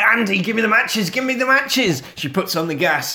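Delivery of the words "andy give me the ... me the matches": high, hurried plea; urgent demand. Retold or imitated. Mum to Andy while fighting Chucky, urgent. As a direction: repeat it with rising urgency.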